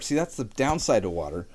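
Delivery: normal talking voice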